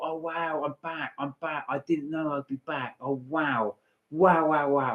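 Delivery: softly overwhelmed, almost to herself; hushed disbelief at being back; awed hush. Retold or imitated. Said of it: "I thought".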